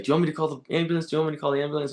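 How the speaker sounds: lightly imitating a panicky passer-by rushing to help; urgent bystander.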